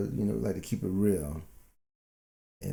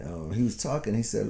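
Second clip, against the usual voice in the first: normal talking voice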